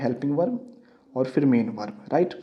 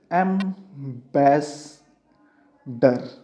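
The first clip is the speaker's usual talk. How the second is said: Ambassador stretched very slowly, syllable by syllable; slow teacher's demonstration of ambassador; drawn out; much slower than usual. Shown as a demonstration. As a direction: spell ambassador out slowly for the class. Teacher spells out word slowly.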